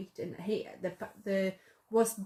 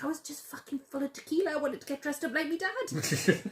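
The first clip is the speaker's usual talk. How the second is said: higher, laughing through a put-on London voice; imitating his accent; 6 semitones higher than usual. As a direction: own it cheekily, half laughing. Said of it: "I can't do the accent".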